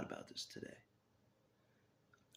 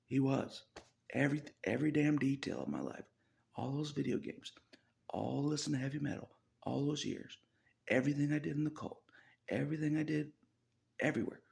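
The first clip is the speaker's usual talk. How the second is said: rising, louder and faster as the list piles up; worked up; 6 semitones higher, 5 dB louder than usual. Real, not acted. Insisting to the listener that Jesus lived every detail. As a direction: hammer through every item, getting heated.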